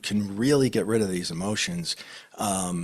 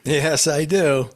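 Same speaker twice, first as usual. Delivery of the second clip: laughing yes; 7 semitones higher, 7 dB louder, slower than usual. Real, not acted. Laughing affirmation after question.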